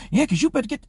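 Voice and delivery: high mock yell, cut off; imitated angry woman. Woman at the door interrupting the peacemaker, imitated high yell. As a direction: butt in high as an irate woman. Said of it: "women outside the door yelling".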